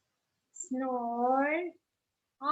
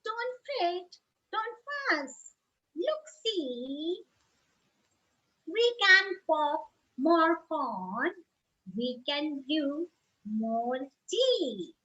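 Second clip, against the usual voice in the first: much higher, small mouse voice, soothing; squeaky mouse; 4 semitones higher than usual. Acted. Mouse calming the upset bear, squeaky and soothing. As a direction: squeak out comfort like a tiny mouse. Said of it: "Mouse squeaks".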